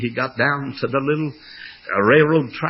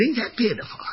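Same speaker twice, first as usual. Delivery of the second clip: Ain't that pitiful pitched high and softer, in mock sympathy; mock pity; 9 semitones higher, 4 dB quieter than usual. Father repeating it to his son, higher soft mock pity. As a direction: coo it like pitying a little thing. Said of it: "I said".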